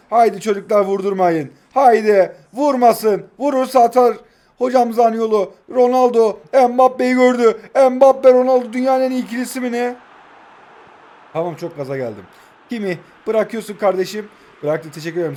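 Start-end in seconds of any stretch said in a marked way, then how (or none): 0.1-10.0 loud, high cheering on the attack; rally the team as the chance builds; urging shouts. Real, not acted. Streamer urging his players on in a rising loud cheer.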